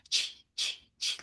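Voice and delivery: soft, voiceless "chee, chee" for bells; whispery jingle-bell noise; imitated jingle bells. Woman showing how the jingle bells went, imitated softly. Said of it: "imitate the jingle bell sound".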